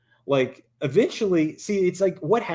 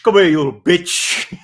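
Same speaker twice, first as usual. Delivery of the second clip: put-on higher, louder sneering bully voice taunting a kid; mock schoolyard bully; 4 semitones higher, 8 dB louder than usual. Host mocks how he'd bully the kid, loud jeering bully. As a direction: jeer it like a playground bully. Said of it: "I would be like".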